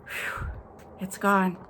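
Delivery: ordinary delivery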